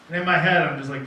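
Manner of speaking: normal talking voice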